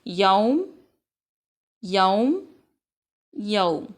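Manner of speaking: यौम said clearly with a long औ; repeated model reading. Shown as a demonstration. Teacher has the class repeat यौम. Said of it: "तीन दफ़ा फिर पढ़ लें".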